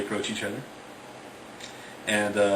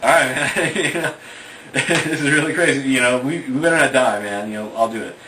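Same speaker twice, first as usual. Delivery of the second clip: loud, higher, laughing through it; nervous laughing; 5 semitones higher, 9 dB louder than usual. Retold or imitated. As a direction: laugh nervously as you agree. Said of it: "And Matt's there, and he's like".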